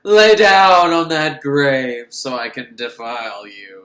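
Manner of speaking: loud, slow put-on character voice; mock graveyard seducer. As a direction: draw it out as a booming, hammed-up command.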